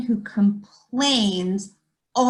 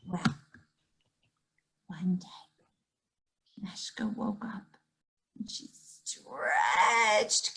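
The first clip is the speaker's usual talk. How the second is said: whispered, slow narration; hushed storytelling; 4 semitones lower, 9 dB quieter, slower than usual. A narrator's reading. Storyteller whispering to the children as Meshka wakes. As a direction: hush the kids into the scene.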